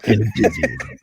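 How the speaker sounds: high squeaky laughing take; laughing trailer voice. Pretend. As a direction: laugh through the trailer line.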